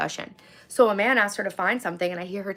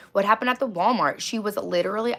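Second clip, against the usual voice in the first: ordinary talk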